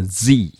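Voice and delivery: Z as "zee", voiced z and long i; American Z. Shown as a demonstration. Teacher explaining common US usage, American "zee" for Z. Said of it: "が濁った音ですね".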